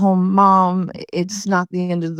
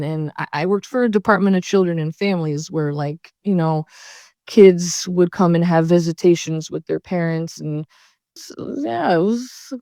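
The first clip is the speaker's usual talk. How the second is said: ordinary delivery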